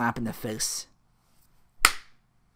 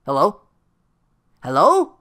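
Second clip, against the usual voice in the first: loud, rising hello; insistent phone calling; 4 semitones higher, 11 dB louder than usual. Retold or imitated. Phil checking a dead phone line, increasingly insistent. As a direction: call louder into a dead line.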